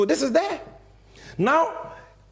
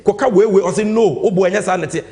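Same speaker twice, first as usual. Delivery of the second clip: much louder repeat of the coaching; loud mock order; 2 semitones higher, 8 dB louder than usual. Mocking Dame ordering the witness, much louder. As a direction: bark it like a boss coaching a lie.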